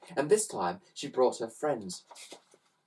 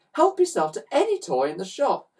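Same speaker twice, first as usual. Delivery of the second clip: higher, with a laugh; calling out gleefully; 8 semitones higher, 3 dB louder than usual. A narrator's reading. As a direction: invite them cheerfully. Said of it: "called out Ned".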